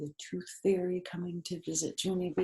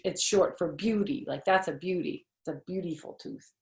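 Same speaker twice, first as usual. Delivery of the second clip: plain reading voice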